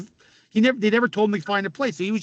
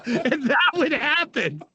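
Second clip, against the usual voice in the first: higher, slow, words squeezed out through laughter; laughing; 8 semitones higher, much slower than usual. Real, not acted. Telling a hockey story to the hosts, giggling through it. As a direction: let the laugh carry the line.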